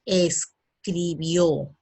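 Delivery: drawn out very slowly, syllable by syllable; slow model answer. Shown as a demonstration. Teacher to pupils, modelling the answer very slowly. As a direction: stretch "escribió" out slowly so the class hears each syllable. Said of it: "la respuesta con el verbo escribir sería".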